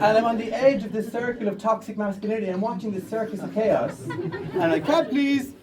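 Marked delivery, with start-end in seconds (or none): 4.8-5.5 high, bright call for a taxi; a mock-polite cry for a cab; a lightly hammed taxi hail. Hailing a taxi mid-fight.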